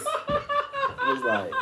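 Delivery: high, slow words through laughter; laughing. Real, not acted. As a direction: squeal it out while cracking up. Cracking up while telling story.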